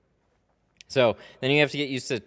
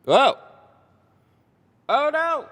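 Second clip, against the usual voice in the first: surprised; 12 dB louder, slower than usual. Real, not acted. Sudden alarm during stream.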